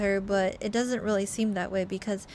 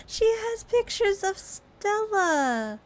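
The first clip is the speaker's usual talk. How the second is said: high, slow squealing alarm; panicked squeal; 9 semitones higher, slower than usual. Real, not acted. Player freaking out over Stella's pictures, high squeal. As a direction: shriek it out in disbelief. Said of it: "excited panicked reaction, high".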